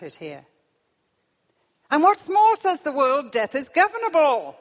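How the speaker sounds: loud, high, emphatic declamation; preaching at full force. Real, not acted. Preacher mocking how the world denies death, forceful preaching. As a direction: hammer the points out high and loud.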